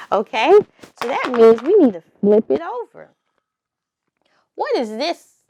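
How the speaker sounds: usual speaking voice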